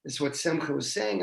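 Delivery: plain reading voice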